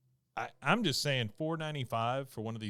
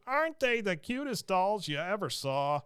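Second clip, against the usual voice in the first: high mock child voice; 9 semitones higher than usual. Acting out dolls ad characters.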